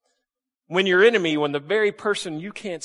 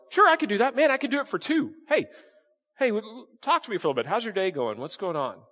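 bright, much higher eager offer; cheerful; 7 semitones higher than usual. Pretend. Mark answering a Roman soldier, cheerful eagerness. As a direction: sound delighted to help. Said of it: "what that cheerfulness would do".

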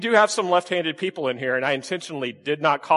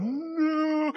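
high, hammed-up wail of no; during the slow-motion slap demo, a high mock cry; 11 semitones higher, 4 dB quieter than usual. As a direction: play the victim with a high fake cry. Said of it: "and I'm going".

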